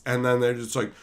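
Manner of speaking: ordinary talk, nothing marked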